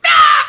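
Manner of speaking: very loud, very high cry; sudden hammed-up squeal; imitated shriek. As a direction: let out a piercing mock shriek. Imitating sound in the story.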